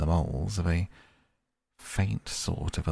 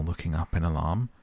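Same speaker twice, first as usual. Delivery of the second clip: usual speaking voice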